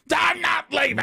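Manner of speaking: high, loud mock-defiant shout; defiant movie quote. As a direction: burst out refusing like DiCaprio. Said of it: "he changes his mind and goes".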